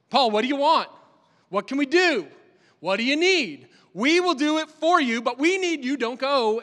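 the friends pleading loudly with Paul not to sail; desperate plea. Acted. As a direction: cry out to him, please stay. Crowd desperately begging Paul.

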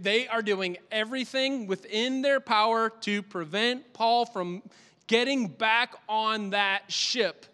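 ordinary talk, nothing marked

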